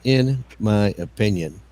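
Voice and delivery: drawn out, very slow mimicry; slow parody of a friend's phrase; slow mocking repeat. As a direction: stretch every word out to mock it. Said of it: "Emo repeated it".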